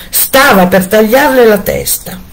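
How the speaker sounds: ordinary delivery, nothing marked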